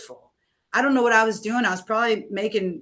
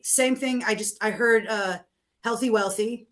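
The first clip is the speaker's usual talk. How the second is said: ordinary talk, nothing marked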